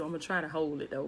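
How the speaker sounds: ordinary talk, nothing marked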